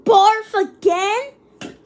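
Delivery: loud, high, slow "Barf again?"; disbelieving. Real, not acted. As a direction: draw it out in dismay. Gets barf flavor again.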